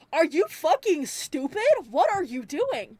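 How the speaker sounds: exasperated scolding of a character; pissed off. Retold or imitated. Viewer yelling at Mike over the music box, pissed off. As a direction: scold him in disbelief, punching the questions. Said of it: "That pissed me off".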